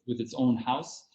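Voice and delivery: usual speaking voice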